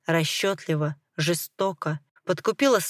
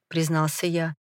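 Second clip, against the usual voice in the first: ordinary delivery, nothing marked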